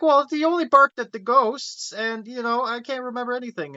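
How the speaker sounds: high-pitched, put-on girl's voice; imitated girl explaining. Retelling a kid on why he barked at ghosts, high-voiced explaining. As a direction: explain it high, as a retold character.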